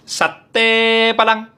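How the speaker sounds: "Sate" stretched high and long, "Padang" punched at the end; Padang satay cry. Retold or imitated. Padang satay vendor calling, long "Sate", stressed final "Padang". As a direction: hold "Sate", then hit "Padang" short. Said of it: "Padangnya ditekanin di terakhir".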